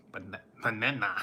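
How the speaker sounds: Minion voice. Retold or imitated.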